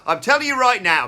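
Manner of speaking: high, loud shout of an all-caps tweet. Shown as a demonstration. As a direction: bellow it like it's typed in capitals. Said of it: "I'm just gonna shout it because it's in all caps".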